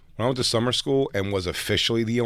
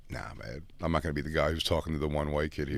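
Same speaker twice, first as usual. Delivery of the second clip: low, much softer dismissal; hushed brush-off; 5 semitones lower, 7 dB quieter than usual. Retold or imitated. Old schoolmate quietly refusing to greet him. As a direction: keep it under your breath, not to be seen.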